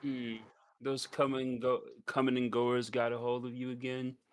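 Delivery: normal talking voice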